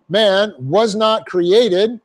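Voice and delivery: "man was not created" drawn out very slowly, each word weighted; teacher stressing the key point; slow emphasis. Shown as a demonstration. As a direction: lean on every word slowly. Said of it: "in the emphatic position".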